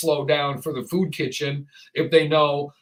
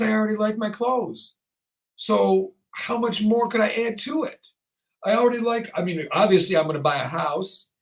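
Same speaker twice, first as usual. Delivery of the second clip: normal talking voice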